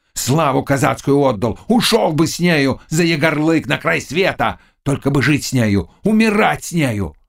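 impassioned. Acted. Cossack declaring what he would give for her, impassioned. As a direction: swear it with aching fervour. Cossack's passionate outburst of love.

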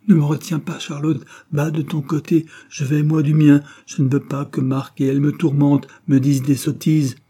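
high, loud narrated outburst; agitated protest. A narrator's reading. Caroline to Charlotte, who holds her back, agitated protest.

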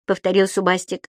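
usual speaking voice

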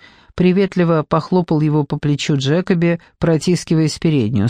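ordinary delivery

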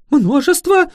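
very high, loud alarmed question; frightened. A narrator's reading. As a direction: squeak the word back in fright. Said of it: "испуганно уточнил крестьянин".

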